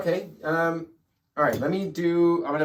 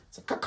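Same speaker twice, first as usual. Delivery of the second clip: shouted crow call, playful; caw-caw bird call; much faster than usual. Pretend. Bird shape in the painting.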